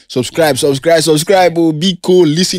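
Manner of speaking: hyped call to subscribe. Real, not acted. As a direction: sell it, stretch each subscribe. Hyping the podcast outro.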